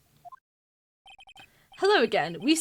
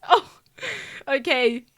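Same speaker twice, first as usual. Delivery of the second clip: ordinary talk, nothing marked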